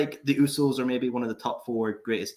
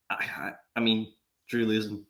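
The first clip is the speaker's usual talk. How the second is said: softer, choked-up fake grief; mock emotional; 7 semitones lower, 6 dB quieter than usual. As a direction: play at being overcome, voice sinking. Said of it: "Sorry, I'm getting emotional".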